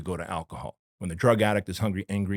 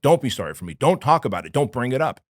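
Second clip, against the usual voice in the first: raised, insistent retold plea; urgent plea; 4 semitones higher, 8 dB louder than usual. Client begging his therapist not to discuss it, urgent plea. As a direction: plead louder, punching each don't.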